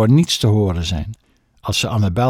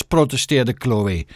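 plain reading voice

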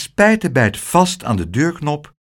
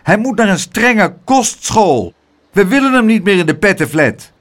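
raised, loud indignant voice; angry calling; 7 semitones higher, 5 dB louder than usual. A narrator's reading. Angry neighbours complaining to tante Pleeg, raised cross voice. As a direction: demand it like fed-up neighbours. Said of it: "riepen de mensen boos".

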